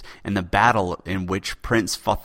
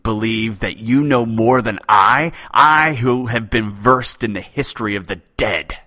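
loud, booming outrage; enraged poet berating king; furious tirade; 3 semitones higher, 7 dB louder than usual. Acted. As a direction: rage at being doubted. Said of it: "the poet's furious tirade".

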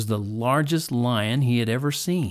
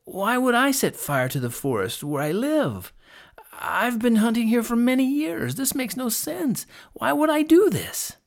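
high, protesting plea, climbing higher; pleading; 11 semitones higher than usual. A narrator's reading. Accused hunter protests to lion, pleading desperately. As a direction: plead innocence with rising desperation. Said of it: "pleaded Sen".

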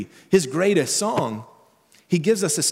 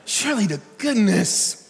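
exasperated; 7 semitones higher than usual. Retold or imitated.

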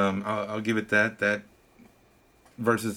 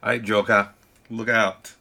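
a quick Boston accent put on for Batman's line, a bit louder; 6 dB louder than usual. Podcaster imagining Batman with a Boston accent, louder. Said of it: "Batman with a Boston accent".

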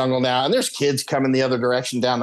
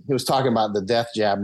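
normal talking voice

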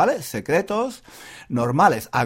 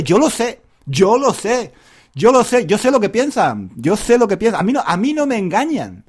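mock smug Spaniard; 6 semitones higher, 4 dB louder, faster than usual. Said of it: "voicing what Spaniards think".

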